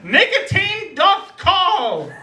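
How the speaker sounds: high, slow theatrical announcement; mock-grand proclamation. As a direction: proclaim it like Shakespeare. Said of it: "I just yelled out this".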